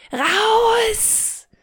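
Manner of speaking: high, drawn-out acted yell; wütendes Anschreien. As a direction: shout them out of the room, long rising vowel. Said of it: "sie schreit dich wieder an". (gespielter hoher Schrei)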